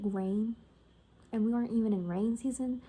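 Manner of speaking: ordinary delivery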